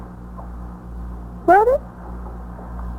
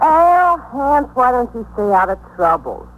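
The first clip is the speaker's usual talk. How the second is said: sharp, higher exasperated call of his name; indignant scolding; 5 semitones higher, 3 dB louder than usual. Acted. Woman scolds Al for his trouble, indignant and higher. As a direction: she scolds the man who dragged her into this.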